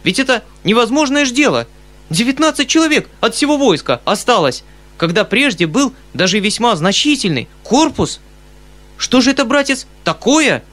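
high, loud, agitated disbelief; incredulous, rising agitation; worried outcry. A narrator's reading. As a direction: protest like a troubled, baffled uncle. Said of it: "вскричал дядя с беспокойством".

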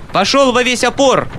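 high, loud order to the coachman; shouting. Acted. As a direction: urge the horses on. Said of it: "закричал Мизинчиков кучеру".

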